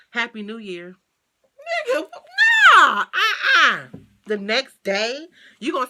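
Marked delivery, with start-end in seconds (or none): 1.9-3.9 drawn-out laughing nah, higher and louder; dramatic refusal. Retold or imitated. To the viewers, refusing his late Happy New Year: drawn-out laughing no. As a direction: turn him down with a laugh.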